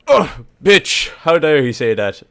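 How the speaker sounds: loud, high mock outrage; hammed-up indignant outburst; offended Northern Irishman. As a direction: act out an affronted Northern Irish listener. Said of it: "from the north of Ireland going".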